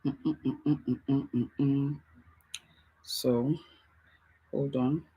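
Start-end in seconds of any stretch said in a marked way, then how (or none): 0.1-2.0 soft, low run of short hums; idle humming. Real, not acted. Host waiting while nobody's responding, humming softly. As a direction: hum softly while you wait.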